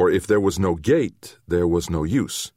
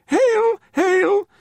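very high, loud tiny voices; little creatures' greeting; 6 dB louder than usual. A narrator's reading. Furry little people hailing the stranger, high piping cry. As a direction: pipe it up high like small furry folk.

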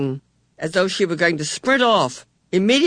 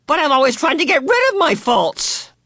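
put-on high, louder girlish protest; young girl protesting; 9 semitones higher, 3 dB louder than usual. A narrator's reading. Meg protesting to Missus Whatsit, high and indignant. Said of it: "Meg cried".

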